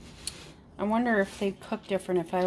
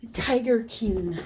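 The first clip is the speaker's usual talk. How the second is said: normal talking voice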